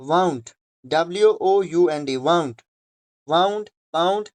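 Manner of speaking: wound said as waund, rhyming with पाउंड; teacher models the past tense of wind; pronunciation demo. Shown as a demonstration. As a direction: spell it out, then say wound like pound. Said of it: "आवाज आपको निकालनी है पाउंड".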